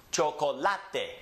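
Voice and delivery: chocolate in Spanish, cho-ko-LA-te; Spanish next-to-last stress. Shown as a demonstration. Teacher models a word for students, chocolate stressed on LA. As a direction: stress the LA in chocolate, every vowel pure. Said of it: "it's the next to last syllable that's emphasized".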